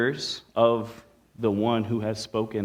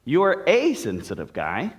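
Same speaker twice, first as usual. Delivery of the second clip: "a" said as a long, high AY; heavy emphasis on "a"; stress on A; 3 semitones higher than usual. Shown as a demonstration. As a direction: punch the little word a, pronounced like the letter A. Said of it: "you could emphasize any of those words".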